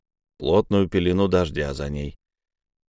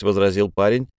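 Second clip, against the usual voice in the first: ordinary delivery, nothing marked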